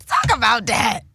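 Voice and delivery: loud encouraging call-outs; church amen corner. Pretend. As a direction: egg on the preacher. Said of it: "you better preach".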